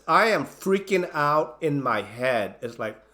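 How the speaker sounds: ordinary talk, nothing marked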